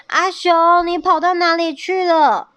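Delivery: high, loud questioning call. A narrator's reading. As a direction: demand loudly where a friend has been. 伙伴质问浮上来的阿熊. (高声喊着质问)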